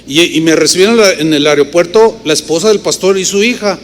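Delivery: ordinary delivery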